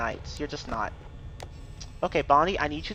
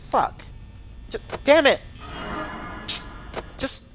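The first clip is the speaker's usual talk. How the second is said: frustrated outburst; 8 semitones higher, 4 dB quieter than usual. Real, not acted. Bonnie won't attack where wanted.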